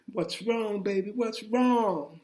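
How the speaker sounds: a light take on a worried wife; wife asking. Retold or imitated. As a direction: voice the wife gently asking what's wrong. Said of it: "she replied".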